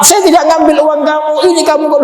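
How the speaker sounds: accused man shouting back at his accuser; indignant denial. Acted. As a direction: fling the denial back at him. Imagined angry denial.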